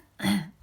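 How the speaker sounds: quick throat clear for a frog in the throat. Real, not acted. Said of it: "got a frog in my throat".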